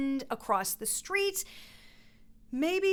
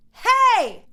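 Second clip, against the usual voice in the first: loud high call across the street; friendly shout; 11 semitones higher, 12 dB louder than usual. Shown as a demonstration. Coach shouting hey to a friend across the street. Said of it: "just give me a "hey".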